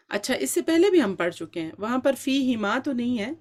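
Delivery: usual speaking voice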